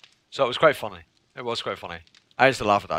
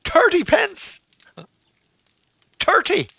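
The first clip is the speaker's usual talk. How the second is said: very high, squeaky incredulity; hammed-up squeal; mock disbelief; slower than usual. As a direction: repeat it in high comic astonishment. High-pitched mocking of southern accent.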